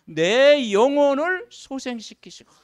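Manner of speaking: slow, loud, measured recitation; solemn scripture reading. Acted. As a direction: read the psalm slowly and gravely. Reads psalm aloud. (천천히 장엄한 성경 낭독)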